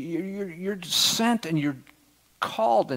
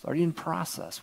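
normal talking voice

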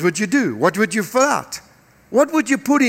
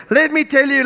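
ordinary talk, nothing marked

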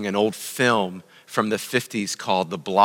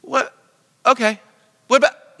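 much higher, louder, flustered; protesting Moses; 14 semitones higher, 6 dB louder than usual. Retold or imitated. Moses pushing back at God again, high and flustered. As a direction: sputter out the next excuse. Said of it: "four times Moses protests".

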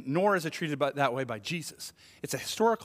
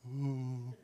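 sheepish ooh; 5 semitones lower, 9 dB quieter than usual. Retold or imitated. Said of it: "just going".

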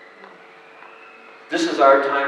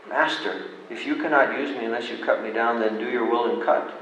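softer, resigned, fast; a touch of a whispered surrender; soft yielding; 4 semitones lower, 8 dB quieter, faster than usual. Acted. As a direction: give way quietly. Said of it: "there came a whisper".

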